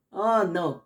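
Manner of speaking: ordinary talk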